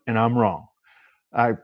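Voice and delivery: ordinary delivery, nothing marked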